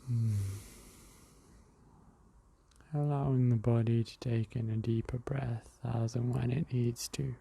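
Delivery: calm breathing guide talking the listener through; guided meditation. Acted. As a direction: ease the listener into the breath, unhurried.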